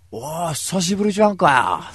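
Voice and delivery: a hint of a loud, rough tomboy greeting; hearty tomboy greeting. Megumi greeting him loudly after three months. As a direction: slap him on the back with words. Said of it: "本当にこういう風に喋るんだからね".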